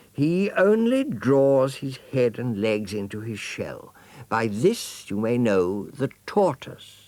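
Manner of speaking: slow, deliberate gracious mother; slow teaching mother. Acted. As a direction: lay it out patiently. Mother instructs.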